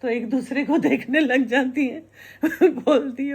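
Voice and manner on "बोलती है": laughing. Real, not acted. Laughs telling the punchline.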